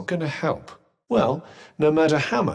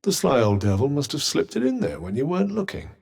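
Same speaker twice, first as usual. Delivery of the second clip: ordinary delivery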